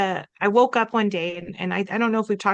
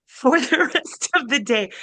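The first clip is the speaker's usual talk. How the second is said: laughing; 5 semitones higher, 3 dB louder than usual. Real, not acted. Telling the podcast hosts about crying all day, laughing.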